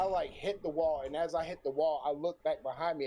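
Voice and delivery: ordinary talk, nothing marked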